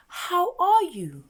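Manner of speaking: loud, high, slow How are you; exaggerated call. Shown as a demonstration. Teacher calling out how are you to the class. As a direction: sing it out big for the children.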